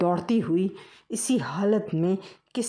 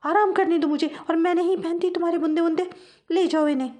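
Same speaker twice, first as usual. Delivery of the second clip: mouse wife snapping high and fast at the end; angry wife mouse; 10 semitones higher than usual. Acted. Wife scolds husband.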